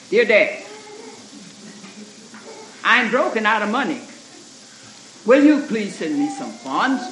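loud, curt reading of the letter; short tone. Retold or imitated. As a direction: read it sharp and short, demanding. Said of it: "he had kind of a short voice".

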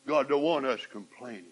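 usual speaking voice

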